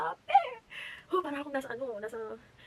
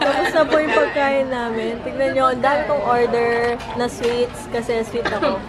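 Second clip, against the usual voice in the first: laughing; 15 dB louder, much slower than usual. Real, not acted. Separate clip-like loud intro, laughing.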